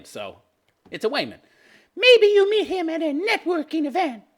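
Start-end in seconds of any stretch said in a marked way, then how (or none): 1.9-4.2 high airheaded woman voice; dopey female voice. Retold or imitated. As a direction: read it like a ditzy woman. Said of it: "affect my dopey female voice".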